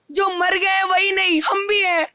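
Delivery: tearful plea with a full throat; choked up. Real, not acted. Shopkeeper pleading to be counted after the stampede, choked up. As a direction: speak through welling tears, voice thick with emotion. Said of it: "गला भरा हुआ है".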